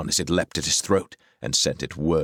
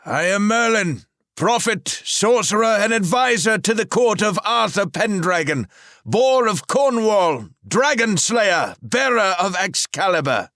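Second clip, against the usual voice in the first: proud wizard; 6 dB louder than usual. A narrator's reading. Merlin announces his titles to Rahal, high and grand.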